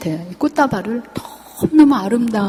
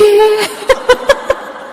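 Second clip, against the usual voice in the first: a bright high giggle of delight; high delighted laugh; 11 semitones higher than usual. Retold or imitated. Patient laughing happily at the clever answer, high delighted laugh.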